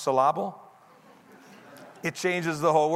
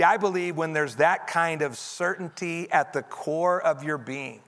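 normal talking voice

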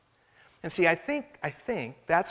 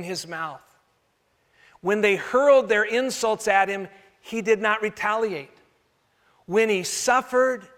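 a preacher reading the Bible to the congregation, solemn; scripture reading; 3 semitones higher than usual. Acted. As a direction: read it like a verse from the pulpit. Reads scripture aloud.

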